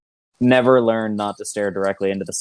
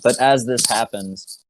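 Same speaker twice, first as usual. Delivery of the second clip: normal talking voice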